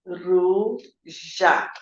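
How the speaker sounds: "ruja" in two separate syllables, "ru... ja", low and soft; low, segmented "ruja" like a child decoding; syllable-by-syllable reading. Retold or imitated. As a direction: sound out "ruja" as "ru", pause, "ja". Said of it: "ela leu assim".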